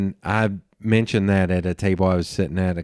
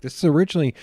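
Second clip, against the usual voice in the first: excited retelling; 8 semitones higher, much faster than usual. Retold or imitated. Retelling explaining a metal art mug.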